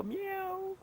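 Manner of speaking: little meow. Shown as a demonstration. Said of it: "how does the kitty cat go?".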